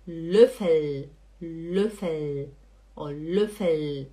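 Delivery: Löffel drawn out on its last L; correct final L. Shown as a demonstration. Teacher modelling a student's word, clear final L. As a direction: hold the L closing Löffel, tongue up. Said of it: "falem esse L correto".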